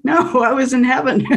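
mock protest through laughter; laughing protest. Retelling her protest to the interviewer, laughing. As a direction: laugh while insisting, half-joking.

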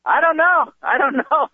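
high, pleading mock bewilderment; panicked dazed child. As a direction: imitate a helpless kid, pitched high. Dazed child answers screaming mother.

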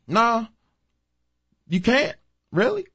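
very high-pitched mock disbelief; exaggerated high sarcasm, words spaced by pauses; sarcastic. Real, not acted. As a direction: squeal it in sarcastic falsetto. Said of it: "I'm being sarcastic, of course".

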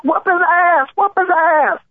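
very high jeering chant; jeering crowd. Retold or imitated. Host imitating fifteen bus voices, high jeering.

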